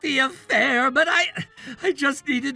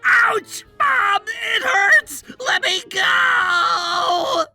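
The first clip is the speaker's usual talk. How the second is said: high, drawn-out howls; yelp, then wail tearfully for release; pained wailing; 11 semitones higher, 10 dB louder, much slower than usual. Acted. Son grabbed by the ear by his mother, howling in pain.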